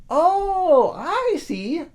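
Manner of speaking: put-on high, loud boyish realization; eager boy. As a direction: foolish boy thinks he understands, high and loud. Boy grasps it.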